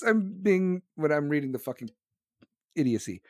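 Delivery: ordinary talk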